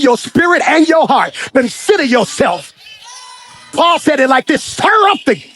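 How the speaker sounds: preacher's climbing proclamation; prophetic declaration. Acted. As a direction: declare it over the whole room.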